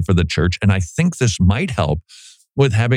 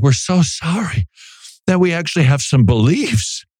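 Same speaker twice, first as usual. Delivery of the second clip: mock apology; 6 semitones higher than usual. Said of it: "giving the impression like".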